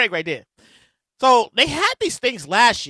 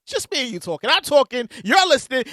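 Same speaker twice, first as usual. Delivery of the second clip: ordinary delivery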